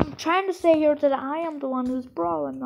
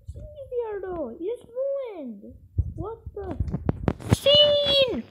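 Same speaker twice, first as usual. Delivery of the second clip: much higher put-on voice, hurt and indignant; betrayed character; 9 semitones higher, 5 dB louder than usual. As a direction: squeal it like a betrayed cartoon ally. Acted betrayed squeaky character outburst.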